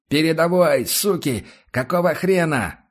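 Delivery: high, loud swearing yell, slowing at the end; furious shout. A narrator's reading. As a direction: bellow it in rage. Ambushed squad leader cursing.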